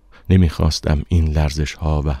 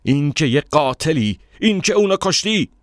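high-pitched accusation, climbing higher; accusing; 11 semitones higher than usual. A narrator's reading. Stranger naming the narrator a killer, accusingly. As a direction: drive the charge home, rising in pitch.

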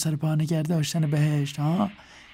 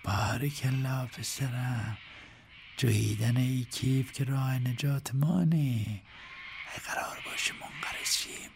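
slow, softer, sinking into a whisper; tender coaxing; 3 semitones higher, 4 dB quieter, slower than usual. A narrator's reading. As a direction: coax the crocodile gently. Softly coaxing the crocodile. (نوازشگرانه و آهسته، با نجوا)

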